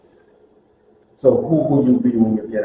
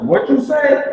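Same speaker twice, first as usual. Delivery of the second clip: mock tough challenge, much higher; challenging call; 10 semitones higher than usual. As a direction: call out to back the speaker up. Inmates in back challenge.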